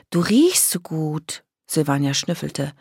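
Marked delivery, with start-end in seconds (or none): 0.1-1.4 put-on slow, drawn-out girlish delight; airy, stretched admiration; savouring compliment. A narrator's reading. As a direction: linger on each word, relishing the smell. Silvania savours grandmother's smell.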